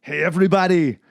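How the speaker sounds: loud villain pun delivery; Mr. Freeze voice. Retold or imitated. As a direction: boom it like a villain. Mr. Freeze impression.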